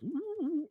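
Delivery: long high "ooh"; a little dragon swooping round, high coo; high creature trill. Acted. Pet dragon scurrying round the tinkerer's head, high whoop. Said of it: "and kinda goes".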